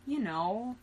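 ordinary delivery, nothing marked

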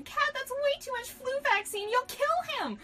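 very high-pitched alarmed cry; Kairi shouting. A narrator's reading. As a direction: call out high in a put-on character voice. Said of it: "shouted Kairi".

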